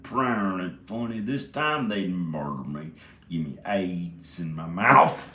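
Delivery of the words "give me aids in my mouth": ordinary talk, nothing marked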